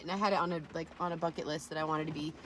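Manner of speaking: usual speaking voice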